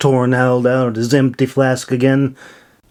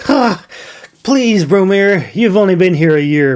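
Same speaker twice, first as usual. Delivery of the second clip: scoffing; 7 semitones higher than usual. A narrator's reading. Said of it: "Milo scoffed".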